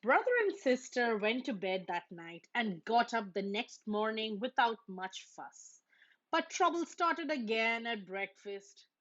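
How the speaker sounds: ordinary delivery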